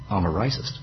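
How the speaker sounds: hushed dawning admission; quiet realisation. Pretend. Preacher voicing someone realising their fault, hushed. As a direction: let the truth land softly. Penny drops, viewer realises.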